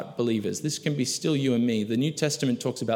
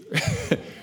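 high burst of laughter. Real, not acted. Preacher laughs at God's answer, high burst. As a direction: crack up at the joke. Laughing at his own joke.

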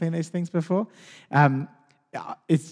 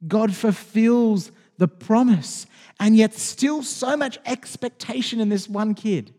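usual speaking voice

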